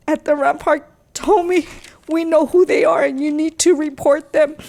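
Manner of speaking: fighting back tears while pleading; crying. Real, not acted. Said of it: "I'm gonna try not to cry".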